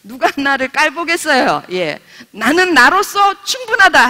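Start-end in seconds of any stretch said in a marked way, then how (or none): 2.3-4.1 proud declaration. Acted. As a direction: declare it boldly, every word weighed. Preacher voices congregation's self-affirmation.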